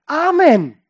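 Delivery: loud, high, resounding amen; ringing shout of affirmation; emphatic congregation amen. Shown as a demonstration. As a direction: call it out with full conviction. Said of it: "Nein, sie sagt eigentlich".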